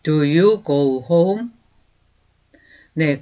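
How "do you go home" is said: slow, clear teacher's model, each word apart; slow demo pronunciation; slow model sentence. Shown as a demonstration. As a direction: say it slowly for students to repeat. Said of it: "다시 한번".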